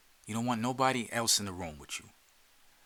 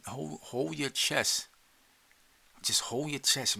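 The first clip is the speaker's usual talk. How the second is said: ordinary talk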